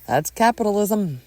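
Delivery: firm, blunt declaration. Acted. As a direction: state it bluntly, no cushioning. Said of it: "very bluntly tells her".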